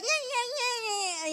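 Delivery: slow, soft, dragged-out brush-off; bored teen waving dad away; dismissive teen. Retold or imitated. Teen brushing off her dad, slow and soft. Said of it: "I'd be like".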